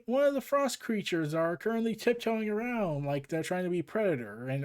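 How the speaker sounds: usual speaking voice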